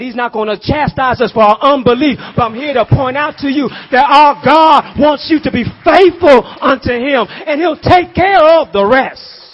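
loud, high preaching shout; full-force preaching. Real, not acted. Preacher urging the congregation to be faithful, shouting. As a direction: build to a shouting climax.

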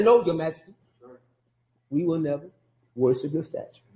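calm, respectful firmness; respectful refusal. Retold or imitated. The three men answering the king with respect, calmly. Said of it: "with all respect to the king".

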